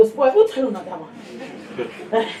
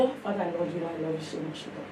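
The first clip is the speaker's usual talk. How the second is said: much softer, low and intimate; soft night prayer; 11 semitones lower, 14 dB quieter than usual. Retold or imitated. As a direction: breathe it out like a prayer in the dark. Praying at night in bed.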